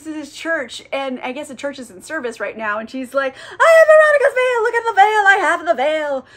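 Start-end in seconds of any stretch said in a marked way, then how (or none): 3.4-6.3 high, loud frenzied proclamation; Dora. Acted. Believer running into a church service, ecstatic shouting. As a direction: shriek it out like a hammed-up zealot. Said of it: "like, freaking out about it".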